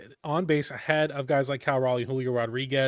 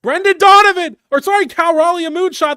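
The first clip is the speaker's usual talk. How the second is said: screaming at the top of his lungs, much higher and louder; home run scream; 13 semitones higher, 10 dB louder, faster than usual. Real, not acted. Fan screaming the home run call to viewers. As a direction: lose your mind over a leadoff homer. Screams at another deep fly.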